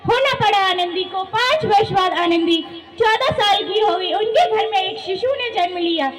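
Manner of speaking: calm narrator voice over music; story narration. A narrator's reading. Narrator tells Anandi's story.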